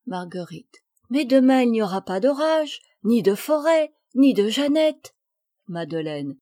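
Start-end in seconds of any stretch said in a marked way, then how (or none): 1.1-5.1 put-on higher, louder childlike protest; lightly voiced girl arguing back; protesting little girl. Marguerite answers her friends' warning about the doll, protesting.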